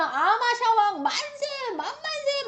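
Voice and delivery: high, stretched-out acted cheer; cheering crowd. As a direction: shout acclaim as the adoring people. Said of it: "막 하고 외쳤을 거 아니에요". (백성처럼 높고 길게 만세 외치기)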